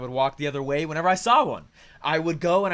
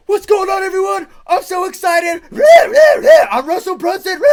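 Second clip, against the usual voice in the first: much higher and louder hyped-up podcast host intro; Russell Brunson hype intro; 14 semitones higher, 15 dB louder than usual. Retold or imitated. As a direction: shout it like an over-excited show opener. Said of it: "he would be going".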